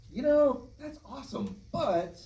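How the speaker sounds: very high, drawn-out diplomatic hedge; tactful high-pitched letdown. Retold or imitated. Filmmaker turning down the sound effects guy, tactfully high-pitched. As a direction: let them down gently in a high voice. Acts out tactful reply to colleague.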